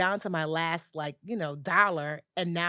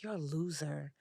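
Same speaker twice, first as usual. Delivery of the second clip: self-scorn; 6 dB quieter than usual. Retold or imitated. Inner self-criticism in bed.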